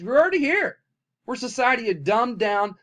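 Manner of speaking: sudden high, loud burst; exclaiming. Real, not acted. Vlogger warning viewers, high loud exclamation. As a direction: throw it up in alarm. Rising rant about robots.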